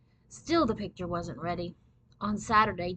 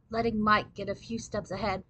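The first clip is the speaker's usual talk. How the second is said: ordinary delivery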